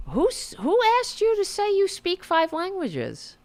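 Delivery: high-pitched, indignant question; incredulous outburst; exasperated challenge. Real, not acted. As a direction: demand it in disbelief, voice pitched right up. Mocking the liar in exasperation.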